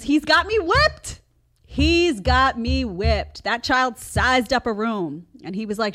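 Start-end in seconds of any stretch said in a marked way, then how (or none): 0.0-1.2 loud, high outburst; exasperated exclamation. Real, not acted. Mom admits her kid controls her, loud exclaiming. 1.6-3.4 loud, drawn-out words; slow emphatic repeat. Real, not acted. Mom repeats admission about her kid, slow emphatic delivery.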